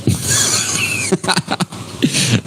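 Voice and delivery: long breathy burst of laughter; helpless wheezy laugh at a joke. Real, not acted. Streamer cracking up at a viewer's own-goal prediction, laughing.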